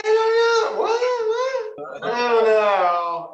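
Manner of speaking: high squeal of laughter, near tears; laughing. Real, not acted. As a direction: crack up so hard it squeaks. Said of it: "this guy's a little cuckoo".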